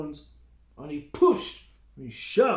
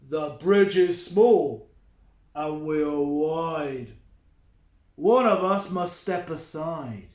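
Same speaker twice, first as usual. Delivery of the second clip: slow, loud troll warning; threatening troll; 7 dB louder, slower than usual. Acted. The troll blocks the big goat, slow and loud. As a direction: lay down the rule, slow and loud.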